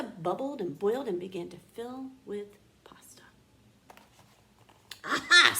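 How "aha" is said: loud, delighted aha; triumphant aha. A narrator's reading. Big Anthony seeing the pot fill, triumphant. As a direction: crow with delight.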